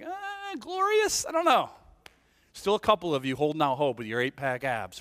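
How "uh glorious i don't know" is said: high, hesitant questioning; comically unconvinced, pitched up; mock doubt. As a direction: act out a sceptical "uh". Voicing doubt about one's body.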